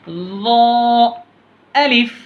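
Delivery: each letter name stretched long and sung out; drawn-out letter recitation. Shown as a demonstration. Teacher showing pupils how to recite letters, long and drawn out. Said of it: "teacher reciting letter names".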